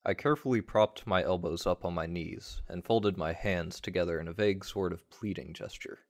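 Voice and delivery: ordinary delivery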